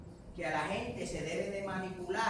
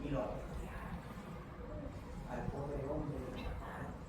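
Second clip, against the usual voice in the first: somber; 5 semitones lower, 6 dB quieter than usual. Real, not acted. Somber close of the stoning story.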